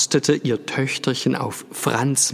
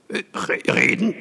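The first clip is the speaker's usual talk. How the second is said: 1 hoarse stammer; 9 semitones higher than usual. A narrator's reading. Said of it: "sagte er heiser".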